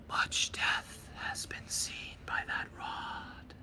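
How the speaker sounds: slow, hollow ghostly whisper. Acted. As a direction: breathe it out slowly like a hollow spirit. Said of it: "very hollow voice".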